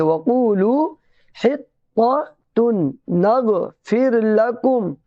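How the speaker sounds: हित्ततुन chanted slowly in separate, careful syllables; joined tajweed reading. Shown as a demonstration. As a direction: chant वक़ूलू हित्ततुन with a held "oo" and doubled t. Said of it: "तो इस तरीके से बोलेंगे". (वक़ूलू हित्ततुन, धीरे तिलावत के अंदाज़ में)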